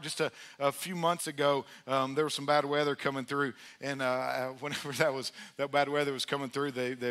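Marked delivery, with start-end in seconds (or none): none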